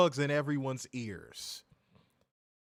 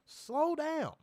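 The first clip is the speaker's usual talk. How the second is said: higher pitched scolding; 10 semitones higher than usual. Real, not acted. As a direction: tell him to ease up. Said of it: "people with a little bit of cadence".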